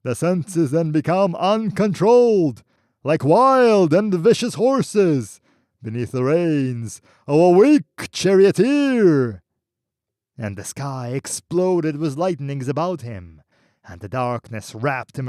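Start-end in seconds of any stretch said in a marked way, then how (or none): 0.0-9.4 roaring voice. Acted. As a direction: roar the verse at his back. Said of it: "roaring at his back".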